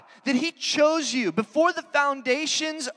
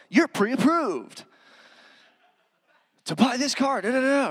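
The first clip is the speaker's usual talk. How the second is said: car salesman voice. Retold or imitated. Preacher mocking a car ad's pitchman voice. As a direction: sell it like a cheesy car-lot commercial. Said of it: "the car salesman voice comes on".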